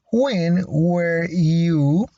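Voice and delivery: slow model question. Shown as a demonstration. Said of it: "teacher models question".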